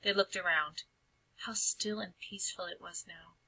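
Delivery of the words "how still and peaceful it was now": hushed unvoiced narration; whisper. A narrator's reading. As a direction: breathe the words out softly. Narrator hushes the calm scene.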